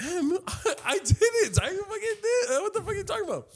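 very high, squealed denial; exaggerated high-pitched disbelief; mock protest. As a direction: protest in a hammed-up falsetto. Acts out his flustered denial.

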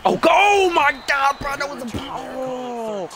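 high-pitched agitated shouting; frantic yell. Real, not acted. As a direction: holler at the runner in real excitement. Yells at punt return.

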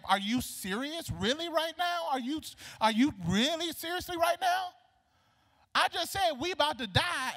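hammed-up disbelief climbing to a high, loud protest; incredulous outburst. Starving widow answers the prophet, mock incredulous and building. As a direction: act indignant, pitch rising as it builds.

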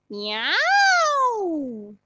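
high ringing cat meow; bright meow. Shown as a demonstration. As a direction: meow with twang. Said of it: "es un sonido brillante".

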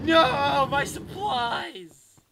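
high, loud cry of dismay, slowed on the last word; anguished shout. Real, not acted. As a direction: wail it at losing everything. Losing supplies in the game.

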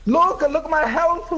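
high, laughing protest; amused, high-pitched complaint. Real, not acted. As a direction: point it out and crack up. Laughing over his low health.

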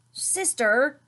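loud, high warning call of her name; angry. A narrator's reading. Brother scolding Sister for teasing, loud annoyed call. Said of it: "said Brother angrily".

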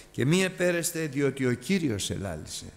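ordinary delivery

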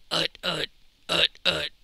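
low, short pig grunts; punchy low oinking noises. A narrator's reading. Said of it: "A muddy fat pig".

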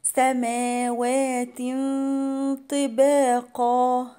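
"سماوات طباقا" with a long hum before ط; nasal concealment. Shown as a demonstration. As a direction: hide the final n of "سماوات" in a held nasal hum. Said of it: "هيبقى فيها إخفاء حقيقي".

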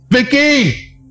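high-pitched yell for missing companions. Acted. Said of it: "I, I will yell".

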